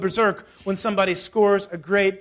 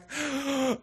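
retold gasp; 6 semitones higher, 8 dB quieter than usual. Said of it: "and I was like".

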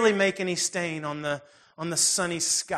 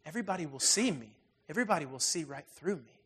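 soft, hushed ashamed voice; ashamed confession; 2 semitones lower, 7 dB quieter, faster than usual. Retold or imitated. Ashamed ghost woman tells the spirit why she hides, soft. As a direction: confess it quietly in shame. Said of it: "She's filled with shame".

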